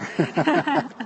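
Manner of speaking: put-on a half-hearted stab at an Australian accent; light, jokey Australian twang, not quite landing; mock Australian accent. Greeting an Australian viewer in a mock Australian accent. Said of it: "would count that as an Australian accent".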